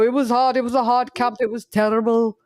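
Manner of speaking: a mildly mocking whine about a hard camp; whiny boxer excuse. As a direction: moan like a boxer who lost. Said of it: "they go".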